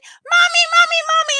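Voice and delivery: high, loud mock kid yell; imitating yelling child. Three-year-old bursting into mom's office, imitated high yelling. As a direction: imitate a kid calling urgently for mom. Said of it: "she'll come into my office and say".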